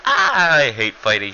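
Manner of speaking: a high squeal on the "Ah", then a sulky whine; lightly voiced, hammed-up reluctant fighter; whiny Furita. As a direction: play the coward who hates fighting. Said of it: "He's like".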